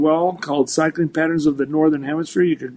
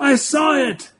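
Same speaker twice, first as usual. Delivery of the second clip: much higher, amazed mentor voice; excited mentor; 9 semitones higher, slower than usual. Retold or imitated. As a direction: blurt it out like a mentor who finally sees it. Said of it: "he goes".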